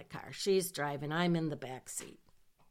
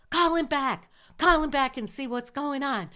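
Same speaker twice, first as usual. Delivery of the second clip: higher and louder, pressing hard; panicked friend in the car urging her to call back, raised voice; urgent demand; 8 semitones higher, 6 dB louder than usual. Retold or imitated. Panicking friend urges calling husband.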